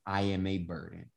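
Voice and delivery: very soft, broken admission of being a burden; quiet tearful confession. Retold or imitated. Crying into the pillow, she finally admits it softly. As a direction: let it slip out hushed, near tears. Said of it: "out came the words".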